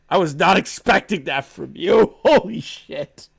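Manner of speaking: laughing in disbelief, slowing down on holy shit; stunned, laughing reaction; shocked laugh. Real, not acted. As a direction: caught off guard and cracking up. Shocked by document's line.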